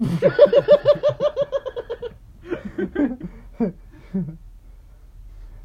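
high, loud real burst of laughter; long loud laugh. Real, not acted. Laughing hard at the friend's joke, high and loud.

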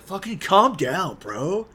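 slow, loud mock Cloud telling him to calm down; uppity brush-off. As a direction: sneer it like a cold jerk. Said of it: "Cloud gets all uppity and he's like".

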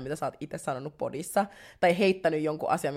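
ordinary talk

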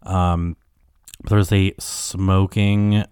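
normal talking voice

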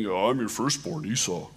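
mock-manly outdoorsman, half-hearted; Esau voice. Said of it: "trying to put on his best Esau voice".

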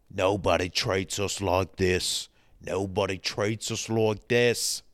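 put-on thick Birmingham accent; Arthur. Middle brother talking to Tommy Shelby, thick Birmingham accent. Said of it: "That's Arthur".

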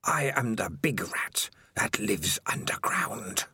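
slow, ghostly whisper from the dark; a creeping, hissing whisper of a lurking monster; eerie spider voice. Acted. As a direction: breathe it like a spider in his head. Spider answers from darkness.

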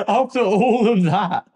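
slow, loud laughing complaint. Real, not acted. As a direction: laugh through the words. Laughing at terrible joke.

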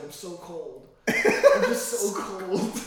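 high, slow drawn-out whimper; mock pitiful whine. Imitating a drunk friend in the tub whining slowly and high. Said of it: "He's like".

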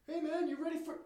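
high excited holler, cut short; calling out. Retold or imitated. Fan turning back to his friend at a show, excited high shout. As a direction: shout eagerly back to a buddy.

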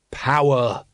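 mildly growled word, drawn out; understated menace from a cult leader. A narrator's reading. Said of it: "growled the Supreme Grand Master".

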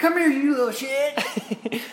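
high, slow hammed-up scolding; mock furious mom. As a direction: play the mom going after her son. Mother voiced chasing son.

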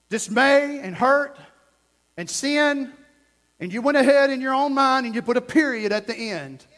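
stern warning to the congregation; forceful preaching. Real, not acted. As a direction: drive each phrase home, pausing between.

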